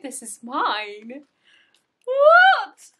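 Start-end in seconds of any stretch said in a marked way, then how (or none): 2.0-2.9 much higher and loud, sharp disbelief; stunned. Real, not acted. Seeing the bag, loud high stunned cry. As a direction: shout it in shock.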